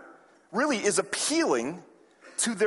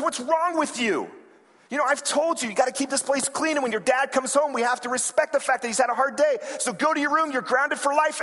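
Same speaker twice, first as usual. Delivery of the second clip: scolding mother; 6 semitones higher than usual. Retold or imitated.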